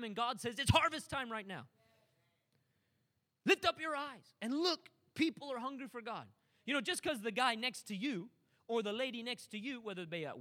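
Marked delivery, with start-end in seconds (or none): 0.6-6.3 urgent preaching. Acted. Preacher calling the congregation to see the harvest, urgent. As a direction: summon them to look up.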